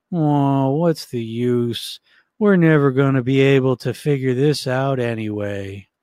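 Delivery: slow, dragging, glum donkey voice; Eeyore. Shown as a demonstration. Coach imitating the sad donkey, hopeless and glum. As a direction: drag it out in a defeated moan. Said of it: "So the Eeyore voice".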